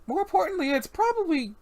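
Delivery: high, lilting character voice; chiding club hostess. Acted. Boss asking the bartender where his tuxedo is, high and chiding. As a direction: needle him lightly in a high voice.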